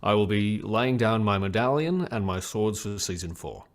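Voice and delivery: put-on grave British-accented farewell statement; Henry Cavill. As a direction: read it solemnly with a British accent. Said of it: "That's a fantastic accent".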